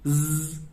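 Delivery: "ज" as a sustained buzzing z; buzzing fricative. Shown as a demonstration. Teacher isolating the fricative for students, buzzing "ज" as z. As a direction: buzz "ज" as a lone z sound. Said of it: "जहां पे buzzing sound आती है".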